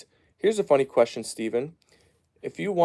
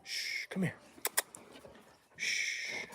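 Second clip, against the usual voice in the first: whispered shushing, much softer; hushed whisper; 6 semitones higher, 17 dB quieter, much slower than usual. Real, not acted. Owner shushing his restless dogs in a whisper. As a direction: breathe a calming shh.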